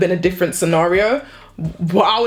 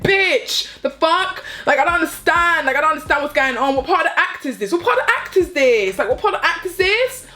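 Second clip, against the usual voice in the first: higher-pitched exasperated disbelief; retold indignant outburst; 6 semitones higher than usual. Woman confronting an actress over a shove, indignant. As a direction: demand an explanation, incredulous.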